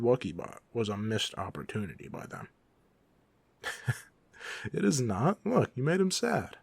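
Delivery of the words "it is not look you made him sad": higher, louder, swooping playful protest; mock indignation. Villain defends the little robot vacuum to the visiting hero, mock indignant. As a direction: protest in exaggerated offence on the robot's behalf.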